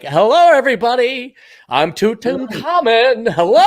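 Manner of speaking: hammed-up flamboyant pharaoh introducing himself. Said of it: "a very flamboyant Pharaoh".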